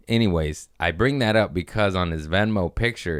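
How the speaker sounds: normal talking voice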